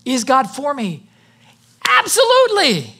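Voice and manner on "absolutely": high, emphatic pulpit declaration; preaching at full force. Real, not acted. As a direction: proclaim each phrase to rouse the congregation.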